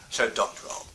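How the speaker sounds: ordinary delivery, nothing marked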